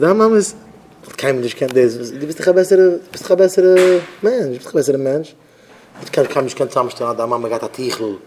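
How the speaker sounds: plain reading voice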